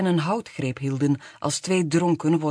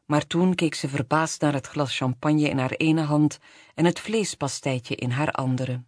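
plain reading voice

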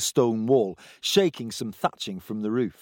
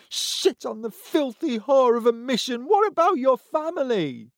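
angry wife; 13 semitones higher than usual. Acted. Angry wife voiced by narrator.

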